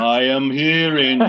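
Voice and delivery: slow, low, eerie vampire drawl. Acted. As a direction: intone like a vampire from space. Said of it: "That's actually my vampire voice".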